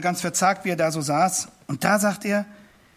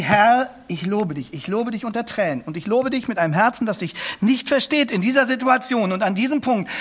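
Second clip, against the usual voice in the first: voicing a prayer the listener should say; fervent prayer; 4 semitones higher than usual. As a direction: pray it out, word by word. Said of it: "und sagen Sie".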